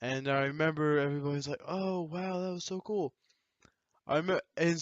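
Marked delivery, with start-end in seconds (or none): 1.7-3.1 impressed classmates, high. Retold or imitated.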